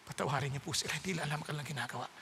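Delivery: whisper-soft mercy for them; gentle forgiveness. Acted. Jesus asking the Father's pardon, very soft.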